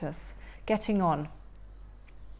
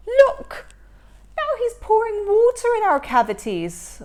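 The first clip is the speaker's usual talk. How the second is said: much higher, louder alarmed cry; 11 semitones higher, 8 dB louder than usual. Acted. Troll alarmed at water poured in the cavities, alarmed cry. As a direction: point in horror at the water.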